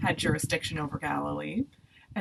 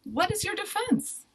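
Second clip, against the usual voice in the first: high-pitched, pressing questions; insistent questioning; 10 semitones higher, 5 dB louder than usual. A narrator's reading. Pilate questions the silent Jesus about the accusations, insistent. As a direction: press for an answer, voice climbing.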